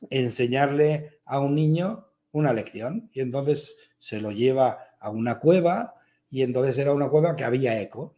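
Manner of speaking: normal talking voice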